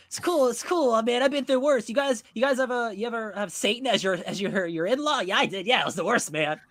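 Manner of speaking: lightly voiced dazed loser, pitched high; doped-up. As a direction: play it loopy and brushing off a beating. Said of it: "She's just, like, doped up".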